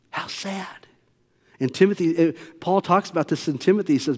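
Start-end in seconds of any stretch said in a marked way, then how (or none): 0.1-0.9 breathy whispered sigh. Real, not acted. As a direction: lean in and whisper it. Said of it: "pastor's whispered remark".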